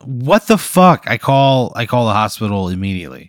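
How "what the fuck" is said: high, slow, drawn-out disbelief; shocked outburst. Acted. Patient reacting to the talking face, shocked. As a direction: stretch it out in shock.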